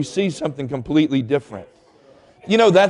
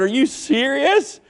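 relieved laughing disbelief; 5 semitones higher than usual. Retold or imitated. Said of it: "I started laughing".

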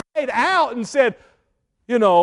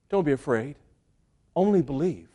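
much softer and lower, tender and calm; soft reassurance; 7 semitones lower, 8 dB quieter than usual. Acted. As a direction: speak it gently as comfort to a frightened father. Jesus comforts a terrified father.

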